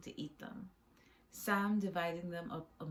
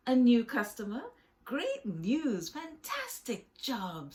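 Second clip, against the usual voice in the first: loud, higher-pitched enthusiasm; excited, emphatic praise in a raised voice; delighted; 6 semitones higher, 8 dB louder than usual. Acted. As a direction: gush over great news. Freddie thrilled at new customer.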